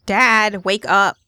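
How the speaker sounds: loud call to a sleeping dad; wake-up yell. Acted. Daughter Amanda yells her sleeping dad awake, loud. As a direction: shout him awake.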